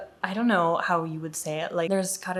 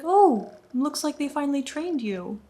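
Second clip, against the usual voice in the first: sarcastic; 8 semitones higher than usual. Retold or imitated. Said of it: "I almost wanted to be like".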